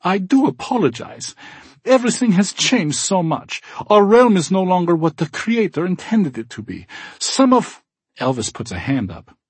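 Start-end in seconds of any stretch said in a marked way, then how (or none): 0.0-7.8 put-on high, troubled German accent; upset German accent. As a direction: apologize earnestly, German accent. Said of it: "genuinely upset".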